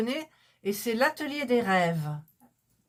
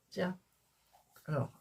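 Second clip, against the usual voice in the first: ordinary talk, nothing marked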